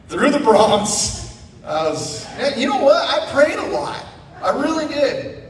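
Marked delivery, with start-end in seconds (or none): 2.4-5.4 high, loud emphatic admission. Real, not acted. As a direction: insist you really prayed. Recalling a scary drive.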